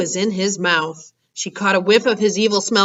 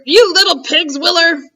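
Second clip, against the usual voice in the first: much higher, louder furious giant; furious roar; 9 semitones higher, 6 dB louder than usual. Acted. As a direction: roar the insult. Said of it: "roared the Blood Bottler".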